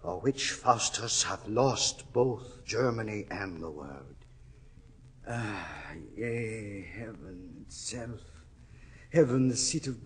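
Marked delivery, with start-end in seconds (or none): 0.0-8.2 lower, much softer, sinking; despairing hush. Acted. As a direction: let it collapse into despair.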